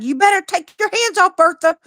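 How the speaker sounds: put-on high, sassy warning from a child actor; playfully guard the couch in a high voice; imitating a kid defending her set piece; mock threat. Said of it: "I'm gonna be like".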